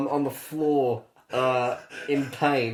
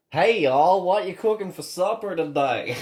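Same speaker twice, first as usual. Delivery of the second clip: higher, louder, slow American southern accent; 4 semitones higher, 3 dB louder, slower than usual. Shown as a demonstration. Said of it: "you can do a southern accent".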